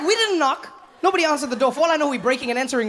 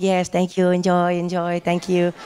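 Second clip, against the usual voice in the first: polite host; slower than usual. Retold or imitated. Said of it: "he's like".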